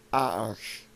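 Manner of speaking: French "arche", like "Michelle"; French pronunciation. Shown as a demonstration. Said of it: "That's how you say it in French".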